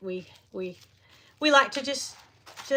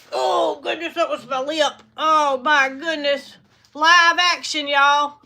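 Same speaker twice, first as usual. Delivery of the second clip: louder, higher startled exclaim; startled outcry; 3 semitones higher, 8 dB louder, slower than usual. Real, not acted. Bitten on the lip live, startled and loud. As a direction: yelp as the bill catches your lip. Goose bit her lip.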